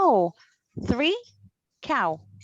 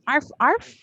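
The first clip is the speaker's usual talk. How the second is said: higher yappy dog bark; playful arf, arf; 4 semitones higher than usual. Shown as a demonstration. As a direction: yap like a little dog. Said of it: "teacher imitates dog bark".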